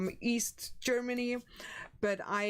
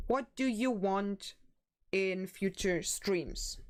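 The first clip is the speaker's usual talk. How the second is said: normal talking voice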